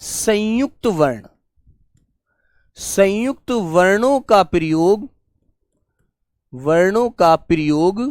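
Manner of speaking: slow, spaced-out dictation with pauses; teacher dictating a rule to students. Shown as a demonstration. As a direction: give the note slowly for students to copy. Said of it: "तो लिखेंगे बेटा number एक".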